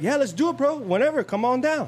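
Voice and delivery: high excited buddy invite; imitating the trooper. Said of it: "He's like".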